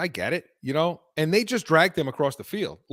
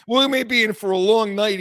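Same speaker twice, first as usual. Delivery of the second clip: louder, higher mock of the co-host; mocking quote; 6 semitones higher, 5 dB louder than usual. Host mocks his co-host's gloomy turn, mocking and loud. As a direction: throw his words back at him, loud and high. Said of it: "he says".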